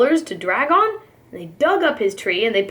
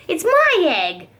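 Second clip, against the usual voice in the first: sputtered; 5 semitones higher than usual. A narrator's reading. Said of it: "she sputtered".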